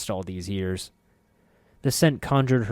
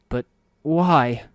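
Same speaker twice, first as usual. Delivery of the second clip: hoarse croak, louder; 5 semitones higher, 8 dB louder than usual. A narrator's reading. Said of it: "Soego croaked".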